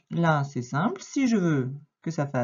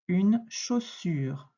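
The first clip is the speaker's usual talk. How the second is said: slowly repeated word, spaced out; slow dictation; much slower than usual. Shown as a demonstration. Teacher repeating the word for a pupil, slow dictation. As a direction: say it at half speed for a learner.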